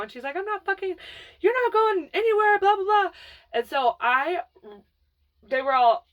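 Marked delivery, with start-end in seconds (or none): 0.4-3.1 sister refusing angrily. Retold or imitated. Older sister refusing to let her go home, angry dismissal. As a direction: shut the kid down hard.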